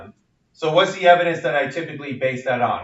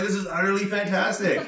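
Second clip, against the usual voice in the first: higher-pitched pretend amazement, laugh creeping in; mock excited; 5 semitones higher than usual. Lecturer acts out marvelling at a talking cow, mock excited. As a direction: gush with hammed-up wonder. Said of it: "you get all excited".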